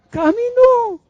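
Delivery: very high, held final vowel; soaring exultant shout; triumphant cry. Acted. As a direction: cry it out loud and long. Triumphant repeat of the climax.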